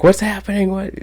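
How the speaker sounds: high-pitched retold panic; alarmed mother. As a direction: imitate a worried mom calling out. Retelling alarmed mother's questions.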